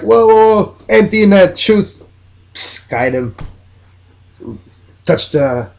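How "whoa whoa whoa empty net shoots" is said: higher, excited yelp of a startled goalkeeper; imitating a keeper caught off guard, pitched up; mock alarmed keeper. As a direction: play the keeper panicking as the shot comes. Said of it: "Keeper was like".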